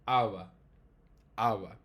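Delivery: awa begun with a throat catch; glottal onset. Shown as a demonstration. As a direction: build air then release awa. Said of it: "starts with an oclusão glotal".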